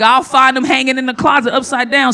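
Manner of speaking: ordinary delivery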